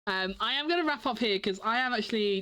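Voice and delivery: ordinary talk, nothing marked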